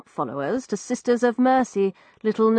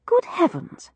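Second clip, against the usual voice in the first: exclaimed; 10 semitones higher than usual. A narrator's reading. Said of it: "I exclaimed".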